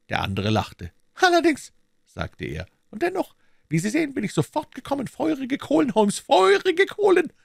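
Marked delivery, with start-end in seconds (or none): 1.1-1.7 much higher, louder voice; mocking, pitched-up villain; sneering villain Smith. A narrator's reading. Smith answers the sick Holmes, mocking high voice. 2.9-7.3 much higher, louder voice; mocking, pitched-up villain; sneering villain Smith. A narrator's reading. Smith answers the sick Holmes, mocking high voice.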